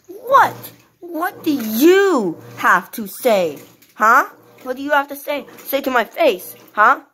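a small toy squaring up; cheeky toy daring someone; defiant baby Teddy. Acted. Teddy picks a fight.

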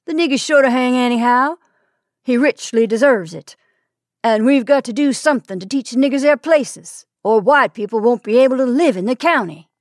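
loud, hard-edged mob leader. A narrator's reading. Mob leader argues for hanging.

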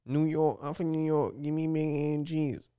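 high-pitched put-on New York accent; exaggerated New Yorker accent. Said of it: "you do an American accent".

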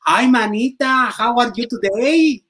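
put-on high, slow, prissy fresa girl talk; posh fresa voice. Imagining himself as a fresa in a show, high and camp. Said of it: "y yo así hablando".

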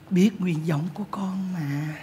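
slow, soothing whisper. Acted. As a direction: whisper to calm her down. Bà bầu thì thầm dỗ dành. (thì thầm chậm rãi, vỗ về)

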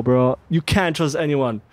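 usual speaking voice

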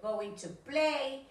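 going to play, drawn out slowly; slow teacher model. Shown as a demonstration. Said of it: "si quiero decir voy a jugar".

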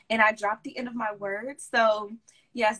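normal talking voice

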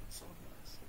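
soft, whispered delight; tender parent. Retold or imitated. Parent thanking a small child for a gift, whispered. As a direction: coo over a child's gift. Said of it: "What do".

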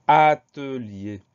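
"atelier" drawn out loudly, each syllable separate. Shown as a demonstration. Teacher introducing the next spelling word, "atelier" syllable by syllable. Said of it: "Première syllabe A".

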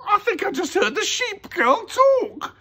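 very high, loud, startled voice; spooked crook's cry; alarmed thief. A narrator's reading. Thief startled by talking picture.

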